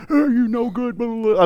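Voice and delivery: high, strained grumbling; struggling rower. Acted. Crew struggling in the boat, high strained grumble. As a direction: grumble through the effort of rowing.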